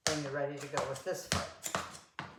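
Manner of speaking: ordinary talk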